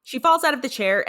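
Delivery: ordinary talk, nothing marked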